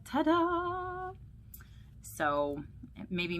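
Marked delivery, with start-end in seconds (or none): 0.0-1.1 high-pitched, playful ta-da; sassy, happy ta-da. Acted. Pastor voicing sassy Minnie showing off, playful and high. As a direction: present it with a sassy flourish. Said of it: "look all sassy and happy".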